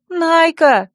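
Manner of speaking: high-pitched alarmed call; cried out. A narrator's reading. Friend tries to stop the heroine leaving, crying out. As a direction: call her name sharply upward in pitch. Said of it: "крикнула Царья".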